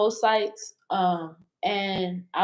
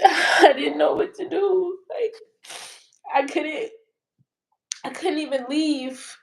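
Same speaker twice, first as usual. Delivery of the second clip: real tearful; 4 semitones higher than usual. Real, not acted. Woman recalling news of his shooting, real tearful voice. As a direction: voice catching, higher with emotion.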